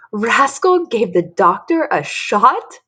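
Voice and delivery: amused disbelief, hammed-up; laughing, amused. Luke teases about Dory at the kitchen table, amused.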